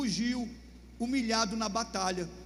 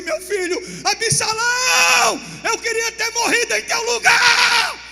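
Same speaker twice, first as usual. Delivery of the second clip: slow, much higher and louder crying shout; wailing sob; 12 semitones higher, 12 dB louder, slower than usual. Acted. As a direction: howl out a father's grief. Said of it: "gritando, dizendo". (pranto gritado do rei)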